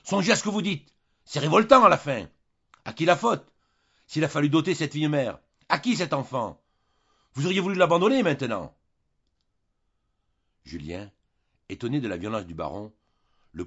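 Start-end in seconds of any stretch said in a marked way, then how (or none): 0.0-8.8 crier fort et haut, de plus en plus vite; éclat de colère. A narrator's reading. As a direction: exploser en tapant du pied. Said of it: "Il finit par éclater, tapant du pied, criant". (le baron éclate en criant contre Julien)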